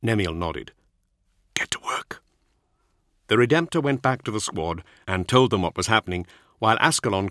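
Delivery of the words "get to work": whispered order. Acted.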